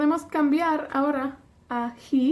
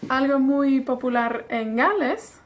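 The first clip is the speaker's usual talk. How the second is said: normal talking voice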